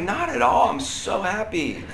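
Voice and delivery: high-pitched protest; mock cheerful denial. Dad assuring family he isn't mad, mock cheerful denial.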